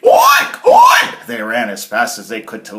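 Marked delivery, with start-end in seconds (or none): 0.0-1.3 very high, loud rising pig squeals; shrieked oinks. Acted. Harold and Herman shriek as the house falls. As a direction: squeal two oinks in fright.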